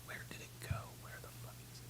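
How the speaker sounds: frustrated unvoiced whisper. Real, not acted. Streamer searching for his recording, whispering curses. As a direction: breathe it out to yourself, annoyed.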